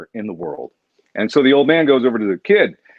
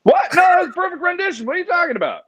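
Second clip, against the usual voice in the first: much higher, squeaky fake outrage; defending his butchered story in a high squeak; mock indignant; faster than usual. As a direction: protest in a hammed-up high squeal.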